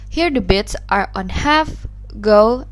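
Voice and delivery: ordinary talk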